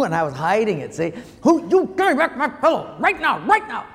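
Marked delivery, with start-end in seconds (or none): 1.4-3.9 high voiced mom with a laugh; laughing while playing his scolding mom; mock angry mother. Son imitating mom demanding her pillow back, high and laughing.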